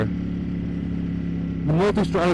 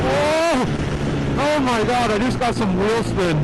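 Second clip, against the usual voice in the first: much higher, a sudden jolt of alarm; startled and excited; 8 semitones higher than usual. Real, not acted. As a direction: caught off guard, voice jumping up. Startled by sudden wheel spin.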